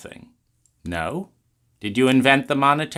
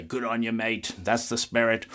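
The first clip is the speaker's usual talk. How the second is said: put-on Australian accent; 8 dB quieter than usual. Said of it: "every Australian would say".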